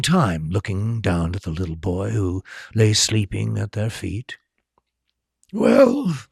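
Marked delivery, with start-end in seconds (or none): 5.5-6.2 Dumbledore voice. A narrator's reading.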